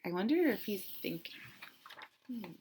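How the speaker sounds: a quick hushed whisper. Acted. To the listening children, a fast secret whisper. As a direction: lean in and whisper the wonder.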